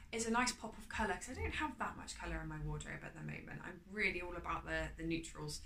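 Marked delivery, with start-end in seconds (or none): none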